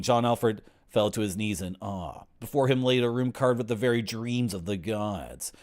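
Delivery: ordinary talk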